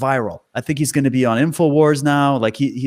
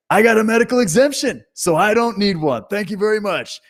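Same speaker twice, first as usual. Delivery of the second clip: higher-pitched imitation of a defiant traveler; cocky mock retort, voice raised in pitch; 7 semitones higher than usual. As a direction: mimic him standing his ground, pitched up. Mimics defiant man's retort.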